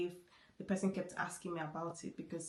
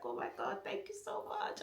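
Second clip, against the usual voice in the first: breathless relieved thanks; 5 dB quieter than usual. Retold or imitated. Overwhelmed relief on passing test.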